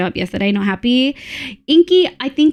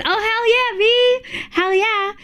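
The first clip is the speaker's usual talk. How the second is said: excited cheer; 11 semitones higher, much slower than usual. Real, not acted. Cheering a viewer's plan.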